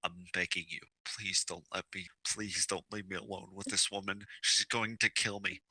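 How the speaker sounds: hushed, low plea; puppy-dog begging. Acted. Husband begs not to be left with his wife, hushed pleading. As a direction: beg softly under your breath. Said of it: "big puppy dog eyes".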